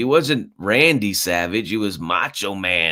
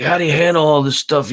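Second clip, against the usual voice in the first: higher put-on wrestler voice, lightly done; imitating Macho Man; 9 semitones higher, 4 dB louder than usual. Retelling Macho Man talking to Mean Gene, a raised imitation voice. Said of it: "and he's like".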